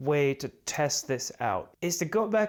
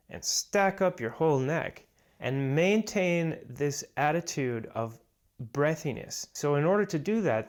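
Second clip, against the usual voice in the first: ordinary delivery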